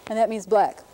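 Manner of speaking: ordinary delivery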